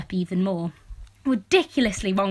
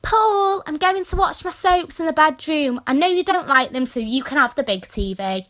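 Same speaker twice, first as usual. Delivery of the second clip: higher, louder sing-song mum fading back to normal; lightly mock the sweet fake innocence; mum's sly excuse; 5 semitones higher, 4 dB louder than usual. Daughter imitating mum fibbing to dad Paul, a hint of put-on sweetness. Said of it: "She will say".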